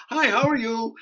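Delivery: high, put-on friendly greeting; totally different voice. Actor greets casting caller, totally different voice. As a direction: pitch it up, sweetly polite. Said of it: "it's, like, totally different".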